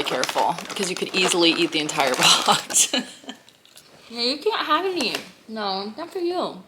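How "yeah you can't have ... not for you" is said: teasing the dog. Real, not acted. Teasing pet over snack.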